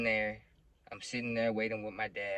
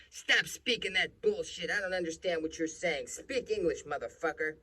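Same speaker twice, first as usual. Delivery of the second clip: high-pitched, louder mock cop tirade; angry cop; 8 semitones higher, 6 dB louder than usual. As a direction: imitate a cop angrily barking orders. Retold cop berates the father.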